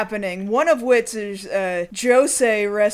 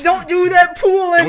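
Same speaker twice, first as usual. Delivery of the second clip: louder hammed-up thug taunt; mock thug repeating the catchphrase, louder; 5 semitones higher, 7 dB louder than usual. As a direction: taunt it like the goon. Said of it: "Sugar cakes".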